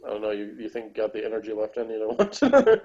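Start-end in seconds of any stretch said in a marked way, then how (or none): none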